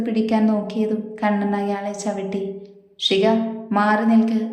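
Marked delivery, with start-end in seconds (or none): none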